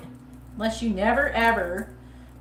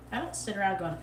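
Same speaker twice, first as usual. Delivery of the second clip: ordinary talk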